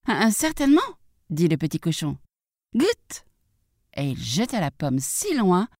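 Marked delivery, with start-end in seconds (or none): 0.1-0.8 high piglet reply; little pig. Acted. Little pig answers the wolf from the tree, high-voiced. 2.7-3.2 high, quick piglet exclamation; little pig. Acted. Little pig offers the wolf an apple, high-voiced.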